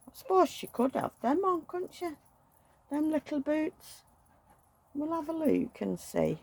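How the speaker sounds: high, sing-song talk to a little one; baby talk. Acted. Woman dressing her doll, talking to it like a baby, high and sing-song. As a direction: coo at the doll as if she were a real baby.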